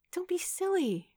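very high, light brush-off; high-pitched dismissal. A narrator's reading. Kate tells Noah not to leave, high-pitched and dismissive. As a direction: wave it away in a high lilt.

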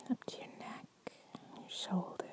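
soft ASMR whisper. Acted. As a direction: breathe it to your partner.

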